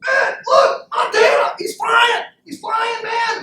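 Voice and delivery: very high, loud excited squeal; amazed shouting. Acted. Penguin spotting Montana in the air, amazed shouting. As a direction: cry out in astonished excitement.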